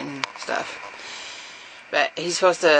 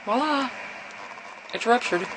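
mock voila; 8 semitones higher, 6 dB quieter than usual. Real, not acted. Mock reveal of the broken line.

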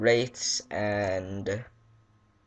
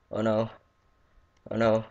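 ordinary talk, nothing marked

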